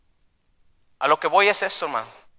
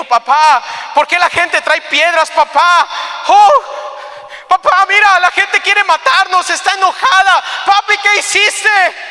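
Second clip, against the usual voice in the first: very high, loud acted child's cry; frightened little child; 6 dB louder than usual. Little boy seeing people with stones, crying to his father. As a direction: wail to papa in growing alarm. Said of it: "yo me imagino a aquel pequeñito".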